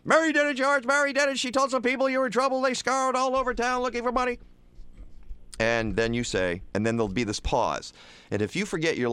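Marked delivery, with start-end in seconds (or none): 0.1-4.4 put-on high, excited old uncle voice; breathless high-pitched uncle rushing the news; Uncle Billy. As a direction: blurt it out as frantic Uncle Billy. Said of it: "Uncle Billy saying something like".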